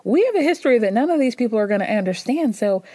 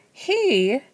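ordinary delivery

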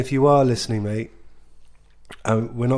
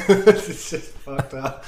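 fighting back giggles; 4 semitones higher, 5 dB louder than usual. Real, not acted. Can't say the accent for laughing, giggly. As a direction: talk through helpless giggling. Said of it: "[name]'s got the giggles".

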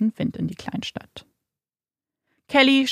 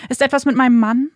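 desperate; 4 semitones higher, 5 dB louder than usual. A narrator's reading. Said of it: "fragt Kelly verzweifelt".

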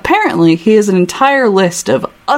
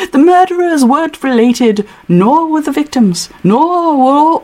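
higher-pitched put-on British accent; London accent, pitched up; Hutch accent; 7 semitones higher than usual. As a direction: stumble through it in an English accent. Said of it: "what my Hutch accent is gonna sound like".

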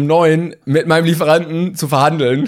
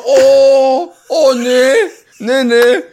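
dismayed refusal; 10 semitones higher, 6 dB louder, much slower than usual. Retold or imitated. Delivery man's dismayed refusal.

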